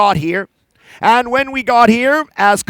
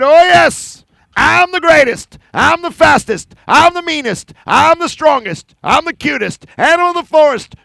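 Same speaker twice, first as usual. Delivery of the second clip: higher, bragging sing-song; boastful bear; 5 semitones higher than usual. Acted. Proud Bear boasting to the forest, higher and swaggering. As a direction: strut and brag to the forest. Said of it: "bragging about himself".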